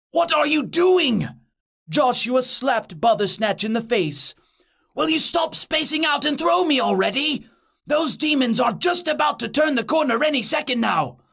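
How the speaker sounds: high, urgent scolding; frantic kid losing patience; exasperated urging. Acted. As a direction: snap a friend out of it, pitched high. Joshua urgently scolds daydreaming friend.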